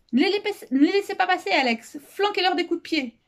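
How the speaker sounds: high-pitched, agitated urging; urgent egging-on. Acted. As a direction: urge an ally to fight them off. Urging Alex to resist attackers.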